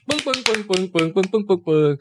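vocal drumbeat sound effect; mouth drum roll. Pretend. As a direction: beat it out like a drum. Episode-end drum sound effect.

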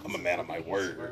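low Joker voice. Retold or imitated. Friend echoing a favourite Joker line in a low villain voice. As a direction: drop low and play the Joker. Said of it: "that part".

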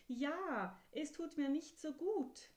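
put-on a child begging for help with its temper; upset little horse.

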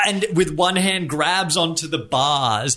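ordinary talk

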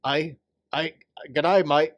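lightly put-on Aussie accent; a hint of Aussie twang on g'day, mate; mock Australian accent. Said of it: "It's not Canadian".

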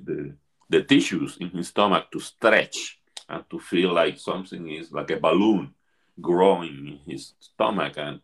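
ordinary talk

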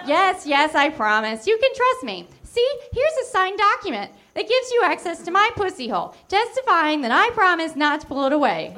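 high, hammed-up innocent Lucy. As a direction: sell the lie in a high sugary voice. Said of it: "explained Lucy".

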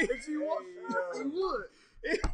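laughing repeat. Real, not acted. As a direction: chuckle as you repeat "would".

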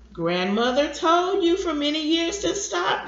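scolding grandson, pitched high. A narrator's reading. Grandson reproaching grandfather for smoking, scolding. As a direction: wag a finger at him with your voice. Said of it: "he shook his finger at John".

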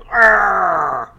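a loud gritted-teeth growl of pain; pained jaw-clenching growl. Shown as a demonstration. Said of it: "You know, you're like".

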